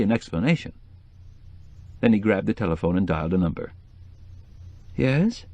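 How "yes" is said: sleepy. A narrator's reading. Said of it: "said a sleepy voice".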